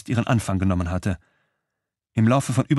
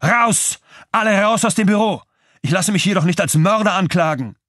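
very high, loud furious yells; yelling; 10 semitones higher, 4 dB louder than usual. A narrator's reading. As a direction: throw them all out at the top of his voice. Benzing throws everyone out.

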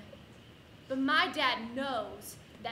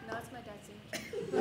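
softer, guilty mumble; nervous quiet denial; 4 semitones lower, 10 dB quieter than usual. Retold or imitated. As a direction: say the lie meekly. Repeats lie to teacher.

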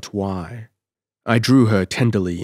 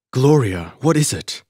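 alarmed; 5 semitones higher, 4 dB louder than usual. A narrator's reading. Alarmed man catching her.

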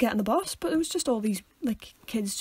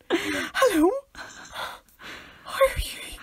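high, breathy whispered greeting that cracks into a whimper; timid squeaky hello, nearly crying; freaked-out hello; 10 semitones higher, much slower than usual. Retold or imitated. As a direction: greet an elder as if terrified of them. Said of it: "I just freak out".

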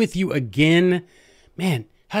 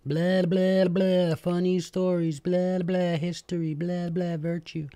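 mock droning lecturer; 5 semitones higher than usual. Mocking his own dull lectures.

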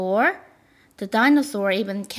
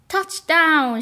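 excited high shout; 7 semitones higher, 5 dB louder than usual. A narrator's reading. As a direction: call out the touchdown. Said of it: "shouted Danny".

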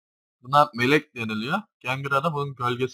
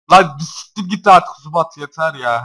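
louder and higher, done with it; fed-up shout; 5 semitones higher, 13 dB louder than usual. Real, not acted. Gamer yelling at yet another Zubat, fed-up shout. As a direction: yell it in disgust.

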